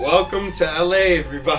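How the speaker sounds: loud high announcer welcome; host voice. Pretend. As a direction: greet the audience like a show host.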